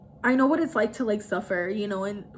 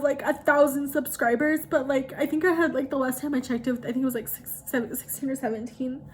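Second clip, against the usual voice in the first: ordinary delivery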